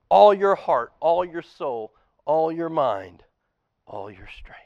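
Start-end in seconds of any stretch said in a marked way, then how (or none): 3.9-4.6 whisper. Real, not acted. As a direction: drop to a hushed whisper. Climax of the love list.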